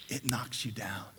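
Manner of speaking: quiet preacher's whisper; hushed whisper. Acted. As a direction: drop to a whisper. Dramatic hush in the sermon.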